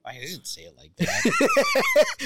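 high, slow, laughing squeal; laughing protest. Real, not acted. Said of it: "You didn't say, like, Don Cherry at all".

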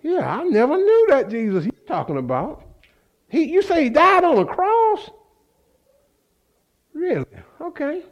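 high, rising mock surprise; feigned ignorance. Peter pretending he never knew Jesus, feigned ignorance.

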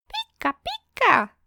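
very high squeaky "Pika, pika", copying her; imitating Pikachu. A narrator's reading. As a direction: mimic her Pikachu to smooth things over. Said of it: "Ik doe Mara na". (hoge piep, Mara nadoend)

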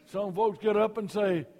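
loud, high revival preaching that builds and rolls; preaching at full force. Acted. Pastor rousing the revival congregation, at full force.

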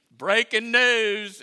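very loud, high, slow announcer call; a booming mock news announcement; news anchor. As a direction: blare it out like a TV headline. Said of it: "announcing to Fox News".

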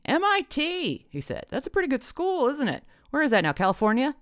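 high, suddenly impressed dad; brightened up. A narrator's reading. Dad hears about the boyfriend's college, brightened up. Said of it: "brightened visibly for the first time".

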